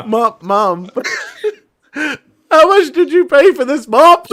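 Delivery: high, loud, drawn-out disbelief cracking into laughter; mock-anguished plea, slow and squeaky; exasperated laughing. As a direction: wail the question through helpless laughs. Exasperated repeat, breaking into laughter.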